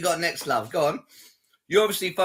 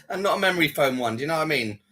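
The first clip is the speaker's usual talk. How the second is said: ordinary talk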